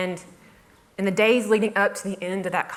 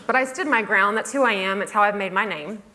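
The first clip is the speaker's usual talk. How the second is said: ordinary talk, nothing marked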